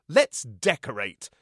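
loud, slow theatrical exclamation; effusive Echo. Acted. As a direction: announce it grandly and slowly. Echo exclaims grandly before exit.

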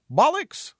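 high, loud cheeky bird; squawking raven. A narrator's reading. Raven answers back to the fool, squawking. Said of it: "replied the raven".

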